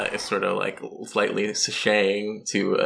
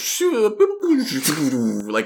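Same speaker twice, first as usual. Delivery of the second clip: mouth-made watery keyboard sound; splashy keyboard sound effect; 6 semitones higher than usual. Acted. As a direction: make a bubbly splash noise with your mouth. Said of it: "he does a little, like, sound effect".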